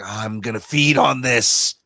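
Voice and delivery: loud, menacing creature voice; growing into a hammed-up monster threat; hungry beard creature. Beard feeding on the sad man's sorrow, monster voice. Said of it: "the beard was like".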